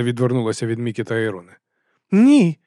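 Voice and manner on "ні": high, loud cry of protest; sharp raised shout. A narrator's reading. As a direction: cry out to stop them. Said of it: "крикнула вона".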